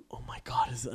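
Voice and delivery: a mother's hushed, breathless whisper; mom's whisper. Retold or imitated. Mom whispering excitedly to her son in a store.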